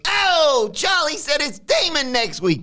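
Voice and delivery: high, slow Tony voice; hammed-up suspicious boss; Tony impression. Tony warning the crew about Charlie, high and slow. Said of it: "Tony says".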